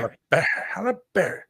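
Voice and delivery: high-pitched, put-on Halle Berry; playful echo. Host echoing co-host's fun with the name, playful echo.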